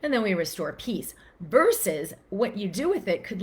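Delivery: normal talking voice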